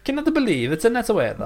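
mock Italian voice about the weather; light Italian accent. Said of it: "sorry to our Italian listeners".

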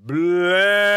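a huge, high, shouted battle cry; deafening cry. A narrator's reading. A creature roaring its war cry at Alice. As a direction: bellow it at the top of the lungs. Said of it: "let out a deafening cry".

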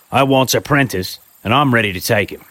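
a pushy chimney-sweep type selling himself; rough tradesman. Acted. Gamfield asks for apprentice.

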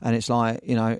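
usual speaking voice

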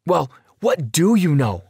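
high, loud, irritated snap; snapping. A narrator's reading. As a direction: snap the question back in frustration. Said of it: "I snapped".

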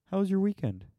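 high-pitched mock small talk; small-talk imitation. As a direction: chirp a routine question in mockery.

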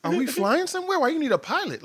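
high-pitched, loud disbelief; mock bafflement. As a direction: squawk the questions in comic confusion. Retells baffled reply to mom.

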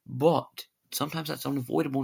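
usual speaking voice

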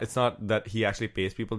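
normal talking voice

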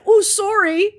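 high, loud jokey Canadian sorry; mock Canadian accent. Joking about Canadians, high mock Canadian accent. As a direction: send up a polite Canadian. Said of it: "They're like".

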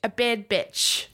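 slow New Zealand accent, bad as bed; drawn-out New Zealand vowels, bad as bed. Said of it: "imagining in a New Zealand accent".

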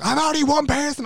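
high-pitched mock protest; flustered airline worker. Airline worker to a pleading passenger, mock flustered squeal. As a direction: squeal it as an overwhelmed staff member.

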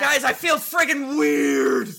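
loud, slow, alarmed cry; alarmed shout. Acted. Character suddenly affected.

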